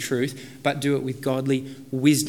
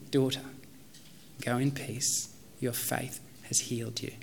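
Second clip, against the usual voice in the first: much softer, gentle blessing; tender Jesus; 10 dB quieter than usual. Acted. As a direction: speak gently to the healed woman. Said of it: "offers tender love and mercy".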